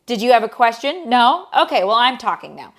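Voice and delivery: a put-on sarcastic scolding; sarcastic tour guide. Tour guide scolding chatting guests, mock-polite and loud. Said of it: "he would be like".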